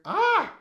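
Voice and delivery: high, loud cry of delight. Real, not acted. Reactor admiring the set design, delighted cry. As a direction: burst out in loud, high appreciation. Overcome by the set's details.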